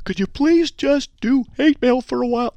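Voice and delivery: high put-on woman's voice; girl voice. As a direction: squeak it out like a woman asking nicely. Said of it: "that's a girl voice".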